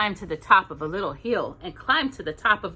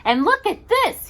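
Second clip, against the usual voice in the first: much higher, louder little bear; 13 semitones higher, 6 dB louder than usual. Acted. Little Bear finds a house on the moon, excited high voice. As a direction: squeal it like an excited small bear.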